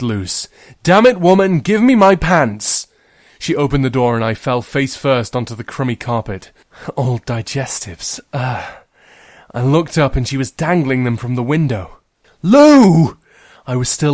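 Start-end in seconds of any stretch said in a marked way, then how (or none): 0.8-2.9 loud angry yell; angry shout. Acted. Girl pounds on sister's door, loud angry yell. 12.4-13.2 loud high scream. Acted. Girl screams at sister dangling her pants, loud high.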